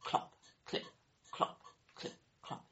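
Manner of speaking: quiet unvoiced clip-clop; soft hoofbeat clicks. Shown as a demonstration. Narrator imitating the donkey coming along, soft clip-clop. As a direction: tap out a donkey's hooves softly. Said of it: "he could hear clip".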